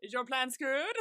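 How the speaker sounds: lightly gloating imitation; mock taunting. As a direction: needle them a little, building to the last word. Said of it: "he's taunting him a, a little bit".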